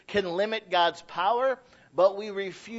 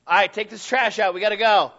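loud raised chore order; parent ordering; 3 semitones higher, 8 dB louder, faster than usual. Retold or imitated. Father tells the kids to take the trash out, loud and raised. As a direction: tell the kids to move it.

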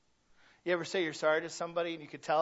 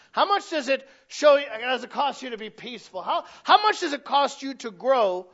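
higher, louder fervent sermon; full-force preaching; 6 semitones higher, 6 dB louder than usual. Acted. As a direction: drive it home to the congregation.

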